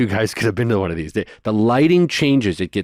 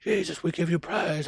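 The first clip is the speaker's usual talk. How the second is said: hushed mock prayer; 7 semitones higher, 9 dB quieter than usual. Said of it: "he's saying".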